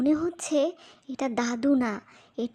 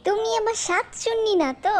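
suspicious; 6 semitones higher than usual. Acted. Suspicious question to grandpa.

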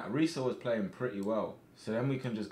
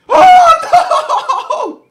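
high, loud laughing cry; laughing shout; 22 dB louder than usual. Real, not acted. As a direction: burst out laughing loudly. Reacting to a goal chance.

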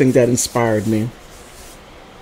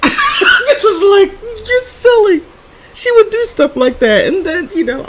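much higher, laughing speech; 3 dB louder than usual. Real, not acted. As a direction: talk through laughter. Laughing about the sister.

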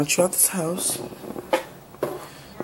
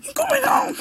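high shout breaking into a cry. Real, not acted. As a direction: yell it while crying. Kind weint im Streit.

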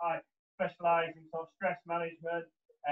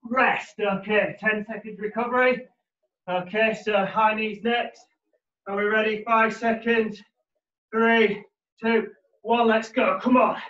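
gym bro; 5 semitones higher, 9 dB louder, slower than usual. Acted. Fitness coach calling workout cues.

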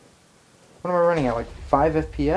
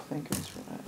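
breathy whisper of relief; hushed thank goodness; 17 dB quieter, much faster than usual. Real, not acted. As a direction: sigh it out under your breath. Relieved aside to self.

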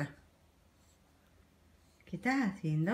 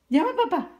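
much higher and louder coaxing; high baby talk; 11 semitones higher, 7 dB louder than usual. Shown as a demonstration. Mother urging her baby to call dad, high and loud. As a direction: lift the voice high to get the baby to say it.

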